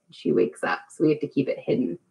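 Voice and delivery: fast hushed aside. Real, not acted. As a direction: drop to a hush so she won't wake. Joking about sleeping daughter.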